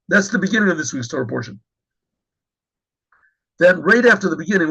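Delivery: usual speaking voice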